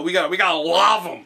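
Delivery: rally the troops as a game minion; Hammer Bro minion. Retold or imitated. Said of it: "have a protest against them".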